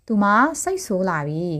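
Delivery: normal talking voice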